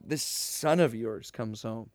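quiet disgusted mutter at his father; disgusted sneer. Retold or imitated. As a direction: drag it out with contempt for the brother. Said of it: "He's so disgusted and angry".

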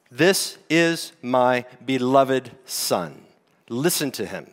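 solemn heavenly voice. Acted. Voice from heaven quoted.

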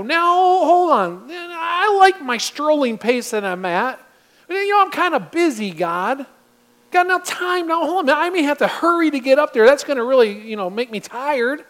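lazy excuses. Acted. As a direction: whine like someone who can't be bothered. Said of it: "He didn't come with all these excuses".

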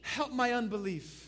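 high, loud plea led for a congregation; desperate cry. Acted. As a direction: cry out for help in prayer. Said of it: "cried out".

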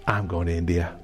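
low and soft, deciding to himself; hushed inner resolve; quiet resolve. Retold or imitated. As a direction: murmur the decision inwardly. Quiet private decision.

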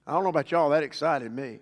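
ordinary delivery

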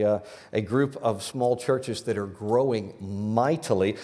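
ordinary talk

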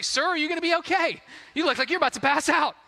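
doctor teasing the woozy dad, high and quick; concerned doctor. Retold or imitated. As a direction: fuss over the faint dad. Doctor checking pale dad.

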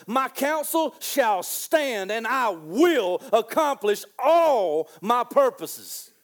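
drawn out, every word weighted; slow proclamation. Acted. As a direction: preach it slowly like God's decree. Preacher proclaims God's word.

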